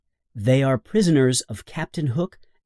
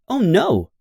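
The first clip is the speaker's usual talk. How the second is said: surprised Oh no; 8 semitones higher, 3 dB louder than usual. A narrator's reading. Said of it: "Wendy is very surprised".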